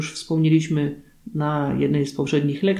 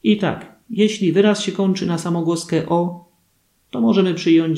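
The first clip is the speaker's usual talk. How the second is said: ordinary talk, nothing marked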